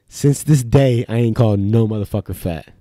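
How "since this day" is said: normal talking voice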